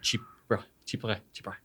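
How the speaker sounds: Chypre with the final r barely sounded; Chypre, just boop the r in at the end; Chypre, r only a quick touch at the end; Chypre, end barely there. Shown as a demonstration. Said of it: "that end is just barely there".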